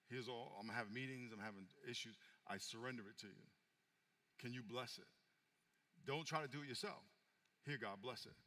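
soft and low, like sharing a quiet secret; gentle pastoral voice. Acted. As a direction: coax the listener gently toward daily prayer. Soft quiet preaching about prayer.

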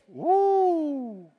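a high, whooping woo; excited whoop. Real, not acted. Preacher whoops at his own point, excited. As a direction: whoop it up with delight.

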